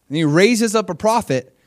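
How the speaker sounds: ordinary talk, nothing marked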